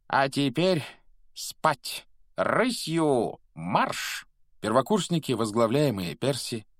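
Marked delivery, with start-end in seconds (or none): 0.1-4.3 high, drawn-out command; calling out orders. Acted. Headmaster sending pupils to bed, calling out. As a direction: send them off with a stretched call.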